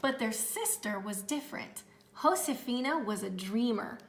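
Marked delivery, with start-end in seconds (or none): none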